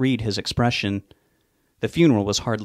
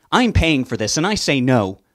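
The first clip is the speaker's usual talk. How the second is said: higher, louder indignant command; angry refusal; 4 semitones higher, 5 dB louder than usual. Acted. Mother forbids speech at the funeral, angry and loud. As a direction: pull rank, she's paying.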